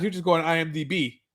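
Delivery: ordinary talk, nothing marked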